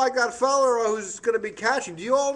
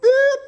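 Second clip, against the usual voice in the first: high loud bleep like a TV censor; mock censor beep; 9 dB louder than usual.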